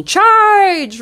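high, loud army charge call; battle-cry shout. Acted. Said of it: "in front of that army".

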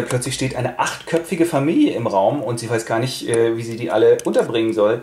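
normal talking voice

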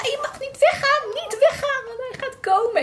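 high frantic pleading; urgent plea. Retold or imitated. Labouring mother begging her partner to stay, high and frantic. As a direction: beg him not to go, pitch way up.

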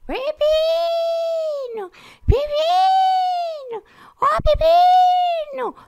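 long, high drawn-out calls; calling out. Retold or imitated. Mother searching the street for her lost parakeet, high drawn-out calls. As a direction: wail his name down the street. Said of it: "sai pela rua gritando".

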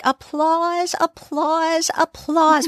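high mock announcement, each word set apart; Iona. Imitating Iona as she staples stuff, high and theatrical. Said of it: "when she's like".

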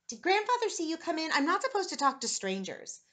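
put-on high, loud child's voice; worried child. A narrator's reading. Boy wary of stranger in his room, worried. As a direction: blurt it out in alarm.

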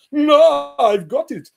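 high, loud cry of triumph; triumphant eureka. Retold or imitated. Bohr bursting in on Einstein, triumphant. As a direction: burst out with the answer.

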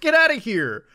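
normal talking voice

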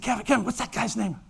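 soft, fast asking on the sly; quick hissed aside so the man won't hear; hushed urgent question. Retold or imitated. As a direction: friend in the booth asking the name, hushed and hurried. Friend asks quickly under his breath.

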